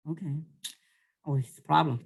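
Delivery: normal talking voice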